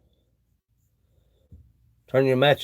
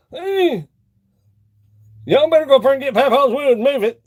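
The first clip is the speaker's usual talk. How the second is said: loud, higher joking yell; mock call out; 8 semitones higher, 5 dB louder than usual. Calling the boys to move papa's wood, joking holler.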